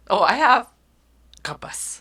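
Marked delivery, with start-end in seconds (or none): 1.4-2.0 soft whispered God bless; hushed old-lady blessing; grandmother's phrase. Retold or imitated. Mother repeating grandmother's whispered "God bless". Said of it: "teach her to say".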